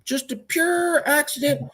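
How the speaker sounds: high, slow, put-on innocent voice; high sarcastic mock innocence. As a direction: feign surprise, dripping with sarcasm. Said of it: "And I go".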